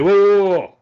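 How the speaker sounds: loud, high, alarmed whoa-whoa; startled protest. Retold or imitated. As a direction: throw your hands up, backing off fast. Bride accuses him, he protests.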